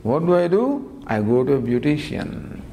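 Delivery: ordinary talk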